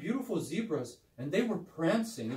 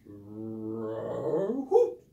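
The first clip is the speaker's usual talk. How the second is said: roar into hoot; 8 semitones lower than usual. Pretend. Puppet owl attempting a lion roar for the pastor. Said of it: "Let me try".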